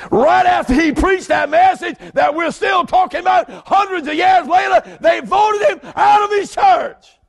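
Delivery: high shouted preaching, slowing at the end; preaching at full force. Real, not acted. Preacher shouting Edwards' fate to the church. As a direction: hammer it home.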